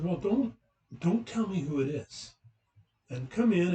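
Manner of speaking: insist, as if setting a test; challenging hostess. Retold or imitated. Hostess challenges him, retold.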